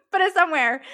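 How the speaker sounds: said through a short laugh. Real, not acted. Host laughing at the advice to hide feelings.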